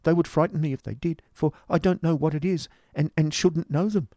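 shivering, despairing cry. A narrator's reading. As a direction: build from fearful pleading to a hopeless wail. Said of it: "cried his companion, shivering".

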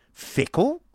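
astonished high, loud cry; sharp leap up in startled question. Acted. Nicholas answers Miss Price's charge, crying out in disbelief. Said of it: "cried Nicholas".

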